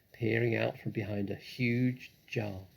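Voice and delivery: ordinary delivery, nothing marked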